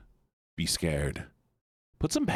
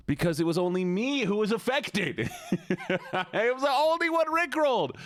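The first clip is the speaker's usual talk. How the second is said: laughing; 11 semitones higher than usual. Real, not acted. Streamer admits a prank backfired on them, laughing. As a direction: crack up while talking, pitch shooting up. Cracking up over rickrolling herself.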